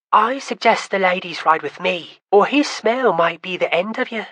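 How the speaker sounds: a playful dig at her brother; teasing young woman. Acted. Kamira offers the ladies a ride, teasing her brother.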